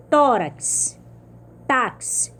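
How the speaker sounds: tórax and táxi, hard stress on the first syllable; penúltima sílaba forte. Shown as a demonstration. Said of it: "se evidencia na penúltima sílaba". (tórax, táxi, com TÓ e TÁ fortes)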